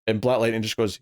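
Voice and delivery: normal talking voice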